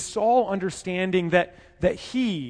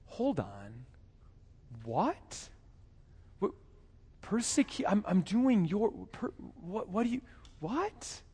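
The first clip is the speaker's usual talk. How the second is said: hushed, stammering confusion with rising questions; bewildered Saul; 8 dB quieter than usual. Acted. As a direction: stumble over the words as if thrown off. Saul confused by Jesus' words.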